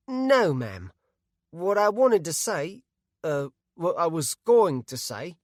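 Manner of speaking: shy Bart. A narrator's reading. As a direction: fumble for words, embarrassed.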